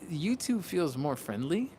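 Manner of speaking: normal talking voice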